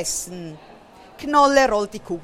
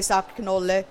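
usual speaking voice

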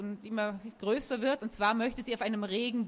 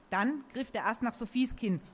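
ordinary delivery, nothing marked

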